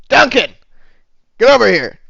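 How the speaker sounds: high, loud summons; calling out. Acted. Barkeep summons the teenager to take over, loud call. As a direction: shout it across a busy bar.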